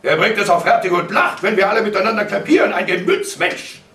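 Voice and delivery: high, loud ranting; furious, sarcastic father; outraged sarcasm. A narrator's reading. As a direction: rail bitterly at his laughing. Father's rant continues.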